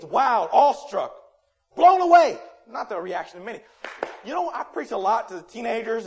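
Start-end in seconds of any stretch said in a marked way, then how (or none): 0.0-2.4 slow, building to a high shout; emphatic preaching shout. Real, not acted. Preacher listing what listeners should feel, rising to a shout. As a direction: belt out each word, peaking on the last.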